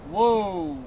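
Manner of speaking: a loud, firm "Whoa" falling at the end; firm command. Shown as a demonstration. Guide demonstrating the stop command to the sled dogs, firm. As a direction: call "Whoa" to the dogs firmly and loudly. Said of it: "That command is".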